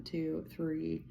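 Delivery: normal talking voice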